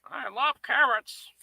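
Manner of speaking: high, slow seagull character voice; a greedy gull savouring its favourite food. Acted. The seagull character declaring love of carrots, high and slow.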